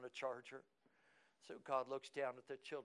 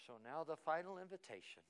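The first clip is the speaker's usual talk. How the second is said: ordinary delivery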